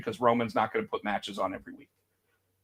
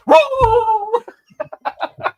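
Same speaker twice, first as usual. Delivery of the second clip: much higher, loud yapping whoa; yappy attack dog; 7 dB louder, much slower than usual. Retold or imitated. Imitating Jey as an attack dog, high loud whoas. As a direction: yap it like a little attack dog. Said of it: "and it's like".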